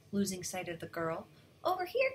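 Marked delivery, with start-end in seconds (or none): 1.6-2.1 high storybook call. A narrator's reading. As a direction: call someone over in a raised pitch. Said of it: "called Chang Chang".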